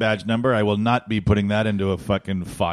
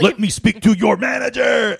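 high, loud, slow Karen demand; mock shout; 12 semitones higher, 6 dB louder than usual. Imitating a customer demanding the manager, loud mock shout. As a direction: bellow it like an entitled customer. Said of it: "quoting entitled customer".

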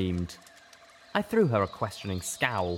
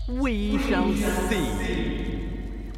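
very slow, higher, ominous; regal alicorn; 11 semitones higher, 4 dB louder, much slower than usual. A narrator's reading. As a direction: let each word hang. Alicorn replies telepathically.

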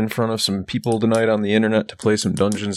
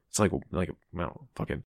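normal talking voice

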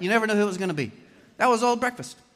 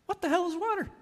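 put-on much higher-pitched young fish voice; baffled young fish; 12 semitones higher than usual. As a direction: ask it high, as a clueless young fish. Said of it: "one of them looks over at the other".

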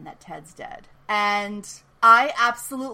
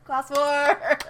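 louder and higher, half-joking; mock proclamation; 6 semitones higher, 5 dB louder than usual. Shown as a demonstration. Host repeating the theme, half-joking proclamation. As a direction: announce it like a slogan. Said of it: "It's in all caps in my notes".

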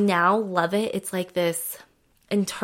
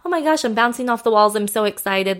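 higher, louder, fast bubbly excitement; mock excitement; 3 semitones higher, 4 dB louder than usual. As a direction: play it like bouncing off the walls. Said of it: "your high of your wave".

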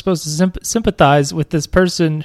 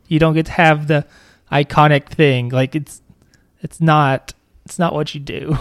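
usual speaking voice

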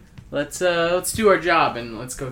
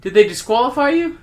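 shocked question; 11 semitones higher, 9 dB louder than usual. Acted. Reporter shocked at news.

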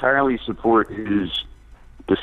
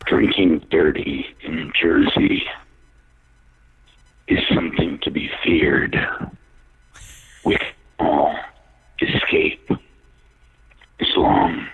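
spooky voice demo; 8 semitones lower than usual. Shown as a demonstration. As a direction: whisper it like a dark movie trailer. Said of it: "Show us your voice".

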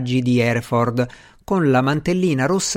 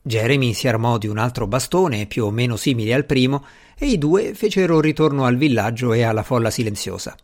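plain reading voice

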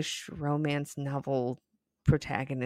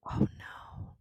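softly whispered oh no; hushed mock horror; whispered dismay; 3 dB quieter than usual. Real, not acted. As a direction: breathe it out in dismay.

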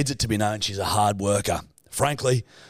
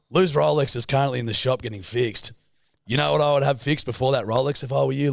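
ordinary talk

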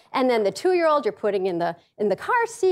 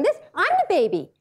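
put-on high, indignant toddler protest; put-on higher kid voice; little kid; 7 semitones higher, faster than usual. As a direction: imitate a small child objecting, rising higher at the end. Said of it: "your little kid is going".

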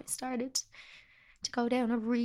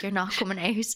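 louder, firm refusal; firm friends; 7 dB louder than usual. Retold or imitated. Friends telling her she's staying in, firm and louder. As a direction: put her to bed and mean it. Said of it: "They were like".

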